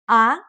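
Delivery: "Ah" with a clean upward glide; second tone. Shown as a demonstration. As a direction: lift the pitch upward through "Ah". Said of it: "and goes up".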